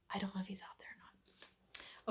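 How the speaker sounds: soft unvoiced whisper; whispering a secret. Real, not acted. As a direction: keep it hushed, someone's next door. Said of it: "I'm whispering because it's a secret".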